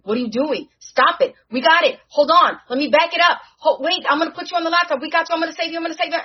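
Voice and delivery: desperately trying to save a dying friend; panicked. Retold or imitated. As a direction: Riri screaming to save Natalie. Riri panics as Natalie disappears.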